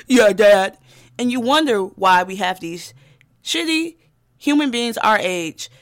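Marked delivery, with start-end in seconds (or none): 0.1-0.8 son voice. Retold or imitated. Sons answering dad at bar.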